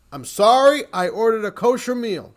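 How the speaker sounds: loud, high public declaration; very loud announcement. Retold or imitated. Professor announcing his meal order to the plane, very loudly. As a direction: announce it so the whole cabin hears. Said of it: "announce very loudly".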